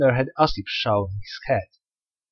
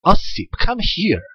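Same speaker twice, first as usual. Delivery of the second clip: raised, higher voice calling over water; 6 semitones higher, 4 dB louder than usual. A narrator's reading. Husband showing his wife how to call him: raised call.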